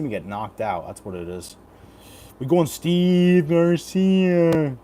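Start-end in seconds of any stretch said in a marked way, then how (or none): 2.4-4.8 slow, high, loud ring announcer. Acted. Drawn-out hype announcement.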